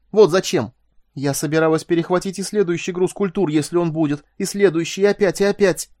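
high-pitched hateful outburst; burning hatred. A narrator's reading. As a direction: let pent-up hatred burst out against your will. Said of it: "Жгучая ненависть ... внезапно прорвалась словами".

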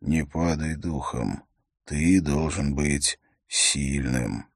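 low, soft, slowing delivery; quiet voice. A narrator's reading. Bearded stranger comforts an exhausted, shivering youth in a quiet voice. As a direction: speak low and softly, drawing out the end. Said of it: "тихий голос произнёс".

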